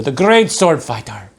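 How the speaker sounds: ordinary delivery, nothing marked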